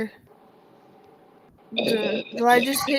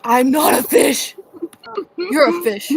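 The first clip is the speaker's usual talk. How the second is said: gurgling fish man croak; 3 semitones higher, 7 dB louder than usual. Pretend. Silly voice in fish teasing.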